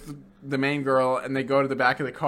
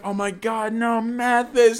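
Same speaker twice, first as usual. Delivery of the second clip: high, slow hammed-up grief; overdone mock mourning; mock lament; 6 semitones higher, slower than usual. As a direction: drag out the fake sorrow. Bond's fake grief, dragged out.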